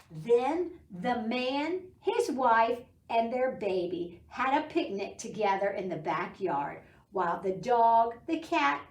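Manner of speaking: bright storybook reading voice; teacher reading a rainy-day book to preschoolers, storybook voice; reading to children. Acted. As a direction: read it like story time for little kids.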